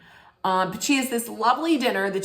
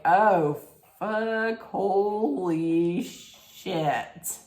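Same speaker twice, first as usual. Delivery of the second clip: drawn-out, very slow "holy shit"; slow-motion mock shock; stunned awe; much slower than usual. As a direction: imitate stunned onlookers, stretching the words. Musicians stunned by the quarrel.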